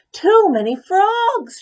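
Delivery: high, loud and slow wail; slow high loud despairing cry; cried out. A narrator's reading. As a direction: stretch the cry out in exasperation. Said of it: "she cried".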